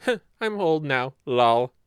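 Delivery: loud, light shrug of a voice; mock carefree senior; breezy old-timer. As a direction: toss it off cheerfully. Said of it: "getting old as".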